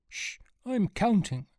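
high, slow hushing reply; shush, then draw it out high; irritated shushing. A narrator's reading. Man counting swings hushes Sean, high and drawn out.

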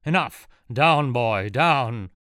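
villain reasserting control, shouting; furious shout. A narrator's reading. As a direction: shout the intruder down like a dog. Villain forces back control.

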